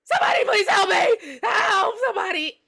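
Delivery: high, loud tearful panic; acted hysterical plea; screaming for help. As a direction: cry out desperately for rescue. Mother screaming for help on phone.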